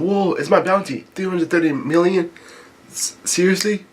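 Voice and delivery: high-pitched, amazed questioning; stunned disbelief. Acted. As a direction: act astonished at your own good news. Sanji acted stunned by bounty.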